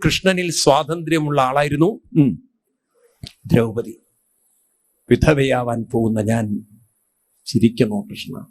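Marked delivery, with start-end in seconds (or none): 5.1-8.5 lower, fading into a choked sob; grieving wife-to-be widow, low and tearful; tearful, broken voice. Acted. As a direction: Draupadi crying out to Krishna, voice sinking. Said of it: "പൊട്ടിക്കരഞ്ഞിട്ട് പറഞ്ഞു".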